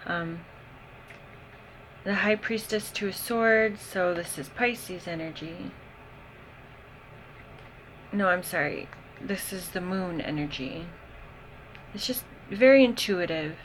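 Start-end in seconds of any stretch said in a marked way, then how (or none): none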